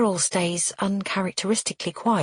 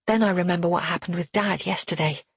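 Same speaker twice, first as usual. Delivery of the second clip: ordinary delivery, nothing marked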